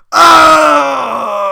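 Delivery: loud high cry of pain; ogre's yelp; pained scream. Acted. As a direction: scream as the arrow is yanked. Arrow yanked out.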